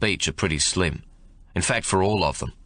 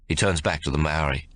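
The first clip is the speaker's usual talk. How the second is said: ordinary delivery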